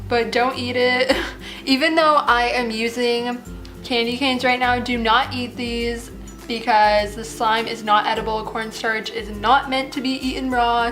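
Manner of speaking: normal talking voice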